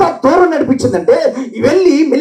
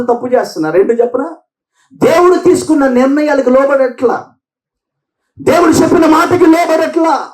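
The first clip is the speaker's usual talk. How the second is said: fiery preaching; 4 semitones higher than usual. Acted. Pastor exhorting his Coimbatore Telugu church, fiery preaching. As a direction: drive the sermon home with force.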